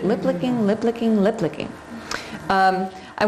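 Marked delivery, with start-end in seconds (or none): 0.0-1.7 said fast. Shown as a demonstration. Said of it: "Everybody say that three times fast".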